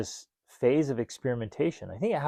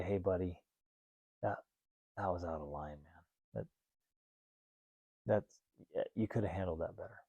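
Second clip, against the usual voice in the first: much softer and lower, a hushed gentle scolding; gentle correction; 5 semitones lower, 11 dB quieter than usual. Retold or imitated. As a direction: ease the child down kindly, barely above a murmur. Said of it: "ever so gently".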